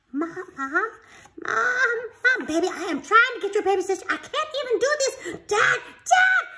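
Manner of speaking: put-on high child calling Mom and Dad; urgent child calling parents.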